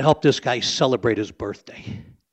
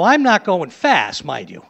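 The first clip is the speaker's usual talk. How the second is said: normal talking voice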